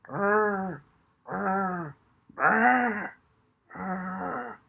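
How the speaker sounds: steady lulling growl; rhythmic dog growl. Acted. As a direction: growl it slow and rhythmic to hypnotize. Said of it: "her essence of Petula growl".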